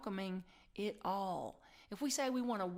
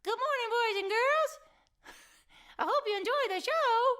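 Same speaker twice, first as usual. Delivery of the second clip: very high, loud squeaky cartoon voice; Mickey Mouse; 9 dB louder than usual. Cartoon mouse greeting children at a show, squeaky impression. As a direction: belt it out as a cartoon show host. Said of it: "talking in my best Mickey Mouse".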